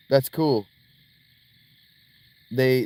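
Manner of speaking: usual speaking voice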